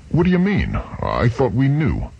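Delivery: high, startled disbelief; puzzled surprise. Acted. George baffled by Chuck's revelation, high surprised question. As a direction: jumps up in surprise.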